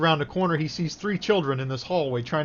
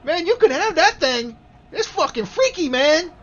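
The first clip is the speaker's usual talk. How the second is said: put-on high, loud child's voice; scared kid character; 13 semitones higher, 8 dB louder than usual. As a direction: blurt it like a spooked little kid. Game master answers as a child.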